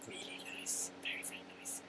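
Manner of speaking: hushed whispered relish. Real, not acted. Savouring polenta with gravy, whispered delight. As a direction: breathe it out like savouring a bite.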